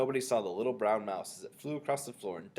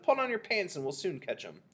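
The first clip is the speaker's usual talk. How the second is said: higher-pitched call, only mildly raised; shouted; 8 semitones higher than usual. A narrator's reading. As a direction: call out the advice. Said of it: "someone else shouted".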